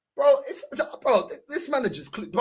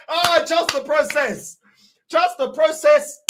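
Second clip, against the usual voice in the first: sarcastic; 5 semitones higher, 5 dB louder, slower than usual. Acted. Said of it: "mocking club slogan".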